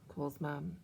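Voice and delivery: plain reading voice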